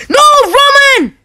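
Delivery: high, drawn-out yell of protest; dismayed shout. Real, not acted. Gamer yelling at Roman mid-match, dismayed shout. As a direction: cry out high and slow at a blunder.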